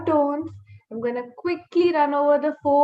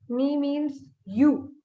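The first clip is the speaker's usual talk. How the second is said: normal talking voice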